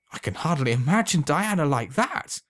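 high, joyful disbelief; overwhelmed with joy. A narrator's reading. Alan to the old man, high and joyful. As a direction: let the joy spill over. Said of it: "overwhelmed with joy".

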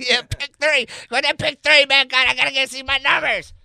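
put-on high, loud drunk yelling; drunk bar patron. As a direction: demand the lottery channel drunkenly. Drunk patron bit continued.

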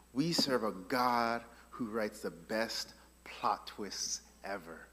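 very soft and slow; earnest hush. Real, not acted. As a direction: lean in, sincere. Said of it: "Please hear me".

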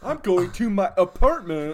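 mock American accent. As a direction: overdo the American vowels. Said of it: "I'm an American".

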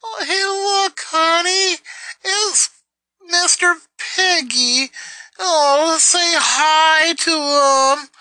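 very high, slow, babyish mockery; ridiculing her stuffed-animal talk in a baby voice. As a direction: coo like a toddler to mock her. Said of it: "bragging about your stuffed animals and saying".

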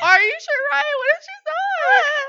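high, loud, stretched worried questioning; mock panic. Doubting Ryan about where the cat is, exaggerated panic. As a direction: escalate into a squealed what-if.

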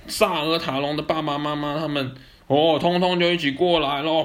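loud, high triumphant narration; excited. A narrator's reading. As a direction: announce the rescue. Rescue arrives, climax.

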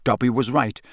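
usual speaking voice

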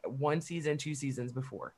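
ordinary talk, nothing marked

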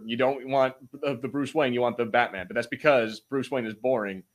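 ordinary talk

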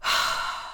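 long breathy sigh; winded sigh ending a rant; exasperated sigh. Real, not acted. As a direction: let out a big sigh. Winding down from a rant.

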